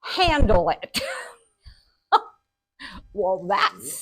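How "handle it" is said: lightly imitated father's firm order; louder, curt dismissal from an unfazed dad; matter-of-fact dad. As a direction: punch HANDLE, then drop it and be done. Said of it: "so matter of fact".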